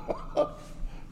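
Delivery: a short squeaky giggle; little breathy giggle; high giggle. Real, not acted. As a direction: giggle at the memory. Laugh at own story.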